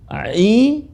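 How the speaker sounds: A, ai, au from the middle of the throat; deep throat ain. Shown as a demonstration. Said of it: "suara dalam". (ain dibaca dari tengah tenggorokan)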